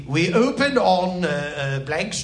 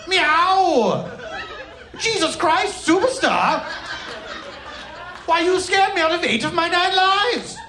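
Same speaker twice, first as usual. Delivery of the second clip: startled cat; 7 semitones higher than usual. Acted. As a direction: yowl in fright, then gush. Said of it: "very startled".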